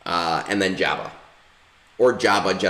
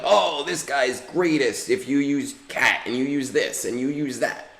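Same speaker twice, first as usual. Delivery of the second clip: higher, sing-song mimicry of other players; mocking other players; 6 semitones higher than usual. Imitating other players who hype up every character. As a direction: parrot what everyone else says, mockingly. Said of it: "They're like".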